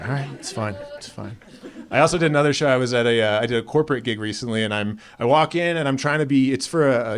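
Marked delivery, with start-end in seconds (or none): 0.0-1.4 hushed reply. Retold or imitated. Hushed reply to wife at wedding.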